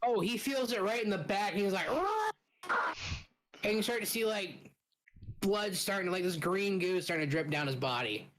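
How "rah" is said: high, sharp monster roar; game master voicing a hurt monster, high roar. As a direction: roar out in pain like a wounded brute. Monster hit, GM voices roar.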